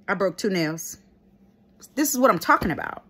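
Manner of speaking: normal talking voice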